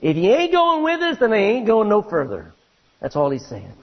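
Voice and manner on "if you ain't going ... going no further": a hint of hillbilly Texas twang; hillbilly Texan. Preacher to congregation, paraphrasing Moses in a light Texan accent. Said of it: "You ready for Texan?".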